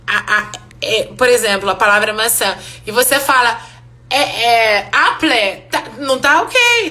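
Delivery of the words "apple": "apple" mispronounced as a learner might; wrong pronunciation. Shown as a demonstration. Teacher shows learners a faulty "apple", mispronounced. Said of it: "tá errada a pronúncia".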